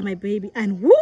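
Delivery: normal talking voice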